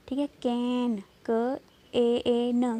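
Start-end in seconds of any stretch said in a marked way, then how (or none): 1.3-2.8 क ए ए एन drawn out slowly, each sound apart; spelled-out sounds. Shown as a demonstration. Teacher slowly sounding out क ए ए एन for pupils. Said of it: "ए लग रहा है ना".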